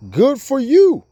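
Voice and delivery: high, loud mocking voice; sarcastic. Mocking the boastful believer in scripture.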